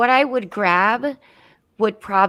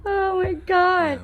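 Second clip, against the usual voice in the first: laughing; 11 semitones higher, much slower than usual. Real, not acted. Helpless laughter.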